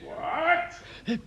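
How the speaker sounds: high, cracking cry of disbelief; shocked outburst. Acted. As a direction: explode in horrified disbelief. Boss learns house was demolished.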